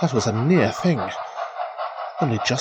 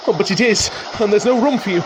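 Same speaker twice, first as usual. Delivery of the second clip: rising alarm; 8 semitones higher, 3 dB louder, faster than usual. Acted. Train bearing down on him.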